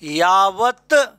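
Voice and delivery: यावत stretched out very slowly to show its parts; slow spelling demo. Shown as a demonstration. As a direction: draw out यावत for the class. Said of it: "देखो इसको ऐसे". (यावत को बहुत धीरे खींचकर बोलें)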